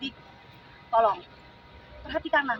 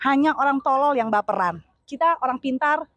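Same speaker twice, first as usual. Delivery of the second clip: loud, punchy campaign oratory; rally the crowd phrase by phrase; rally speech; 7 dB louder than usual. Real, not acted. Supporter urging fellow supporters toward change, rousing and loud.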